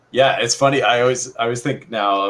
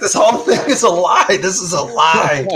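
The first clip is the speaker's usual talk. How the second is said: high, loud mock-indignant protest; mock outrage; 9 semitones higher, 4 dB louder than usual. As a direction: cry out in hammed-up outrage. Acting out Conan's outraged reaction.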